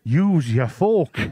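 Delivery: a slow nagging voice in his head; inner voice. Retold or imitated. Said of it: "a voice in me back of me head going".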